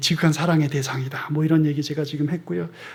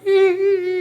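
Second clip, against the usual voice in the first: imitated sob; 13 semitones higher, much slower than usual. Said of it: "막 우는 거예요".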